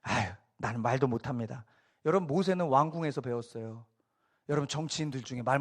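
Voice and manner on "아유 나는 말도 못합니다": low, mock-reluctant excuse; mildly sarcastic self-deprecation, pitched down. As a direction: beg off with a lightly acted sigh. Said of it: "막 이러면서".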